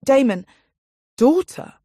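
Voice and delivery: high alarmed hiss of a name; shocked. Acted. Irini shocked at outburst.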